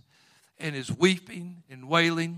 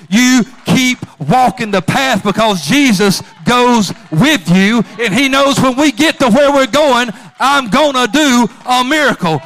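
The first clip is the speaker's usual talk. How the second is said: much louder and higher, shouting the sermon; full-force preaching; 6 semitones higher, 15 dB louder than usual. Acted.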